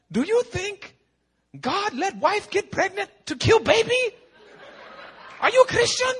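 loud, very high imitated Chinese accent; Chinese-accented pastor. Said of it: "I look at the Chinese pastor".

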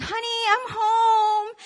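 high, drawn-out singsong call; husband arriving home. Retold or imitated. Husband coming home from work to his wife, singsong greeting. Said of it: "he comes home from work".

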